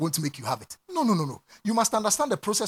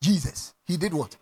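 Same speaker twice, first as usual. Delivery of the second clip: normal talking voice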